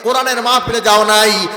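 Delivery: forceful, driving sermon delivery; preaching. Acted. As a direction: preach it loud to the crowd, drawing out the line endings. (জোরালো ওয়াজের সুর)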